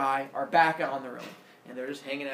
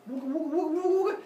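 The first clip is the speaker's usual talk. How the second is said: high chanted wooga; high sing-song flying sound; whooshing noise; 12 semitones higher, 3 dB louder, much faster than usual. Retold or imitated. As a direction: mimic the spear whirling.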